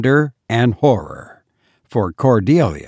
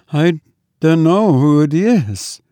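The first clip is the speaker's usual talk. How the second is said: halting, frightened maid, raised pitch; gasped; 4 semitones higher than usual. A narrator's reading. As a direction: gasp it out, faint with fright. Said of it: "gasped Cordelia".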